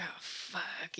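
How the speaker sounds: breathy stunned whisper, under the breath; whispered oh fuck. Retold or imitated. Man staring at dorky girl, hushed stunned whisper. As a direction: whisper it in shock. He's stunned watching her memories.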